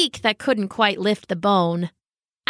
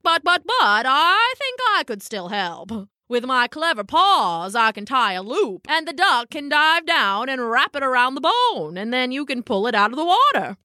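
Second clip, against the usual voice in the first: pompous raccoon, eager; 3 semitones higher than usual. Acted. Raccoon pitching his plan to the dog, grandly eager. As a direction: play a clever raccoon proud of his idea.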